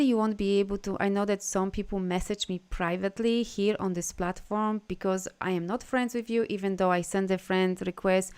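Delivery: ordinary talk, nothing marked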